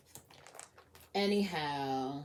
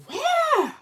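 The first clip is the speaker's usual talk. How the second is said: high, loud excited question; eager cry; 13 dB louder than usual. Acted. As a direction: call out, desperate to see. Acted onlooker answering.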